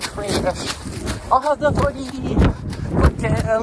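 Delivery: mock friend voice. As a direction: play a cheeky mate grabbing footage. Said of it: "it's like".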